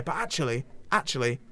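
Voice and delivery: host playing a cheeky northern English voice actor; lightly put-on northern English accent, chatty and blunt; hammy northern English character. Host plays Nolan from North.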